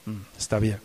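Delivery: low, soft, unimpressed hum; flat muted joy. Shown as a demonstration. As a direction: shrug off the joy quietly. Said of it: "el regocijo para ti es". (alegría apagada, baja y suave)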